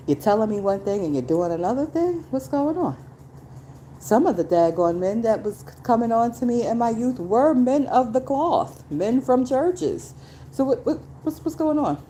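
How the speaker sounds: high, disbelieving challenge; incredulous call-out. Retold or imitated. Calling out church men who preyed on her, high and incredulous. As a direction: confront them in disbelief.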